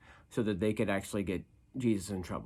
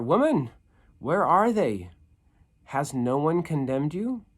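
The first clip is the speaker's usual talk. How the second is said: raised, higher, louder question; Jesus asking; 5 semitones higher, 5 dB louder than usual. Acted. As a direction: call out to the woman. Reading Jesus' words aloud.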